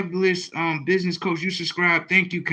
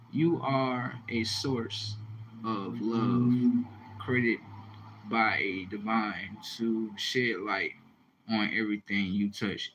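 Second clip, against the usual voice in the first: soft slow reading; 7 semitones lower, 9 dB quieter, slower than usual. Retold or imitated. Reading viewer comment softly.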